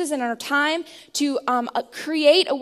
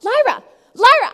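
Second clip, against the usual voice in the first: put-on high, loud calling of a child's name; yelling her name; 8 semitones higher, 10 dB louder than usual. Mom calling her daughter to get pajamas on, loud and high. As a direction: call across the room to a distracted toddler. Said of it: "I'm, like, yelling her name".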